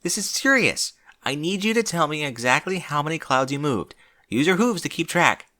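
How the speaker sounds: a touch of a stern teacher; stern order. A narrator's reading. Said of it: "like her mentor in a dire mood".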